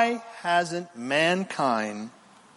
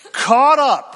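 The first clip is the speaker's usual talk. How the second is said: exultant proclaim; 9 semitones higher, 8 dB louder than usual. Real, not acted. Preacher exclaims rapture verse.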